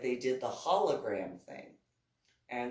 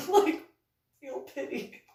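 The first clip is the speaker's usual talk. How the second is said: higher, half-laughing plea; mock pleading; 8 semitones higher, 5 dB louder than usual. Real, not acted. Begging the ride not to break again, mock plea. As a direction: beg for mercy, half joking. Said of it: "pleading with the ride".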